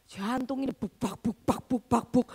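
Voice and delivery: low thumping bubak bubak of a racing heart; heartbeat imitation. Mother imitates her racing heart at the mall, thumping. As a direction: mimic a pounding heart with low thuds.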